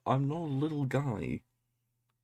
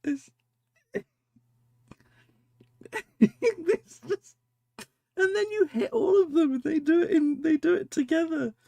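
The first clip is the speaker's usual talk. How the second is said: very high, loud, teary laughing through the words; laughing till she cries; crying with laughter; 9 dB louder than usual. Real, not acted. Laughing so hard she cries over the picture. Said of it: "Oh, I'm crying".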